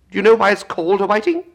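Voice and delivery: a high, loud squawking Gryphon explaining. Acted. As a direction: pitch it high and bold as the Gryphon.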